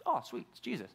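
breezy, cheery voice imitating the disciples; lightly voiced disciples pleased to see Jesus; mock cheerful relief. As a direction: toss it off as if it were no big deal. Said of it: "And they were like".

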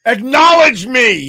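slow, loud commanding "Acknowledge me"; Roman Reigns impression. Podcast host booms a wrestler's catchphrase, slow and loud. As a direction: demand respect like a wrestling champ. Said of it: "He pulled a full, a full Roman Reigns".

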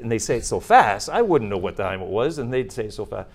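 ordinary delivery

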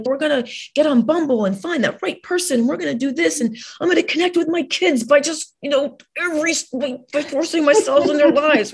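high, bright-eyed mock enthusiasm; eager mock voice. Mocking people desperate for connection, in a high eager voice. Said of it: "We're like".

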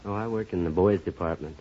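ordinary talk

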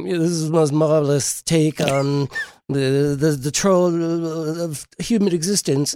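hammed-up snobbish film critic; snooty art critic. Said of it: "I love his critic impression".

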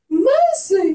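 very high, loud exclamation; piercingly high, drawn-out outcry; acted shocked protest. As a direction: cry it out in mock dismay. Acted protest at worst thing.

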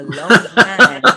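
slow, laughing aside. Real, not acted. Joking about dating herself, slowly laughing it out. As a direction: let the words dissolve into a laugh.

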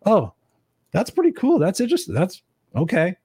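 high-pitched dawning 'oh, cool'; pleasant realisation. Acted. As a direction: voice lifts as the idea lands, acted out.

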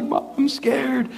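put-on high, slow little-girl whimper; scared little girl. Little sister in the dark, high and scared. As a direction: sound small and frightened. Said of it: "my sister's like".

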